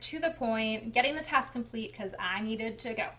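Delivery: usual speaking voice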